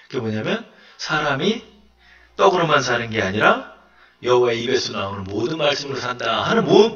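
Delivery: ordinary talk, nothing marked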